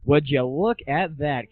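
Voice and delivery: usual speaking voice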